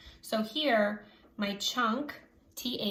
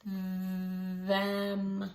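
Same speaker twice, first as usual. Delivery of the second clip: Them stretched out long and slow; said slowly. Shown as a demonstration. Teacher stretches the word Them for the class. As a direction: draw out Them, each sound held. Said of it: "say it slowly".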